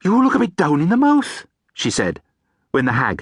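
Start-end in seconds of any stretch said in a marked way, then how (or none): 0.0-1.5 higher, chatty busy banshee; a flustered neighbour run off her feet. Acted. Banshee neighbour borrowing sugar from the hag, flustered chatter.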